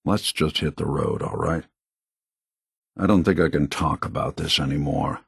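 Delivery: low, subdued resignation; weary hurt. Acted. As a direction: close the painful subject, wearily. Too hurt to keep talking.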